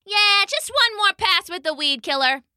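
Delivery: high-pitched mild holler back; shouting back. A narrator's reading. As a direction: call back to a workmate. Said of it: "the human shouted back".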